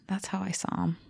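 a soft breathy whisper of a yes. Real, not acted. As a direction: whisper it aside. Whispered aside.

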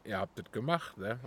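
usual speaking voice

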